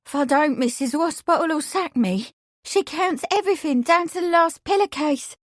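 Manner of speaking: high, pleading, near panic; a scared girl begging not to lose her job; desperate maid. Acted. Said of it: "she replied desperately".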